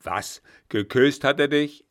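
put-on an old general hearing his ward was kissed, outraged and loud; outraged old marshal. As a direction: bellow it in shocked fury. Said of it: "brachte den Alten fürchterlich in Harnisch".

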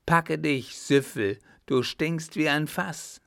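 high, loud insult; angry call. A narrator's reading. Rope dancer shoves the drunkard away, calling angrily. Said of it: "Sie gab ihm einen Stoß und rief".

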